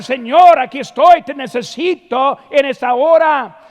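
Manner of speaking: loud, high pleading shout; loud urgent plea to the Lord; shouted prayer. Shown as a demonstration. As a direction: cry out with all your lungs. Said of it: "usemos los pulmones".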